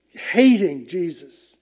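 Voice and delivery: usual speaking voice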